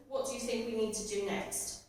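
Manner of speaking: normal talking voice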